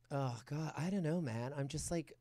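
laid-back West Hollywood American accent; American accent, like an exasperated West Hollywood conversation. Shown as a demonstration. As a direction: put on an American accent, casual LA chat. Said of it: "Can I hear your, um, American accent?".

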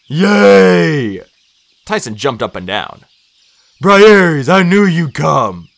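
loud, high, overjoyed cheer; big drawn-out happy yell; Tyson. A narrator's reading. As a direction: shout with childlike delight. Tyson cheers his hero's arrival.

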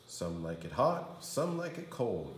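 usual speaking voice

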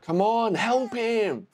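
exasperated call for help; urgent plea. Real, not acted. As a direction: plead at the screen, pitch up. Urging film character to help.

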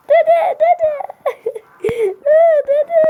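very high, loud wail for grandpa; anguished wailing. Acted. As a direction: wail over a fallen grandfather. Grandchild cries over shot grandfather.

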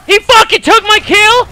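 real furious yell; furious shout. Real, not acted. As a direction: scream at the stolen kill. Kill stolen.

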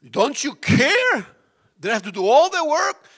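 high loud accusing cry; loud complaint. Retold or imitated. The lady accusing Jesus, loud complaint. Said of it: "And the lady said".

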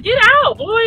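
urging. Retold or imitated. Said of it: "And I was just like".

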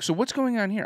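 high mock wife question; imitating wife. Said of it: "she'll be like".

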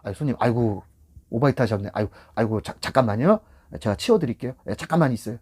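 calm, accommodating driver; gentle concern. Shown as a demonstration. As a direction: reassure the customer kindly. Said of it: "이렇게 말하는 거 틀리잖아요. 어감이".

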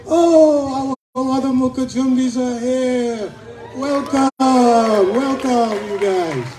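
high, drawn-out shout of greeting; calling out welcome. Real, not acted. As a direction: hail new arrivals across a crowd. Calls out to arriving stilt dancers.

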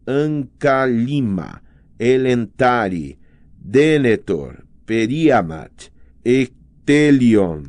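slow, each name spelled out with its stressed vowel leaned on; stressed syllables shown. Shown as a demonstration. Reading example names to show where stress falls, slow and careful. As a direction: read the names deliberately, hitting the stressed syllable. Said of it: "a vogal tônica está destacada".

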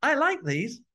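put-on very high, slow and pleading child voice; excited little kid. Boy showing mum the Star Wars toys, high pleading voice. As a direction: draw out the toys like a begging kid. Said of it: "I was like".

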